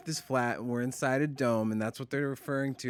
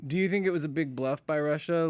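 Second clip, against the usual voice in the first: usual speaking voice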